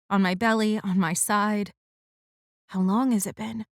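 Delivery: ordinary delivery, nothing marked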